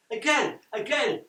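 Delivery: high, loud playful call; shouting. A narrator's reading. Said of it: "shout both ball and wall".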